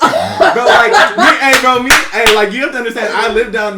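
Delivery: loud high laughing exclaim; excited laughing. Real, not acted. Bragging about clout down there, laughing loud. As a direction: burst out laughing, eager to tell.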